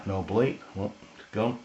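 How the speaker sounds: ordinary talk, nothing marked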